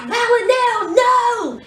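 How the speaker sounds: imitated desperate shout; mock cry of no. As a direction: try to stop her too late. Eve crying out to stop killing.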